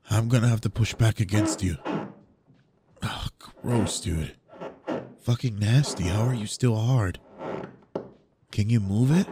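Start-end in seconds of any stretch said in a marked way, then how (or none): none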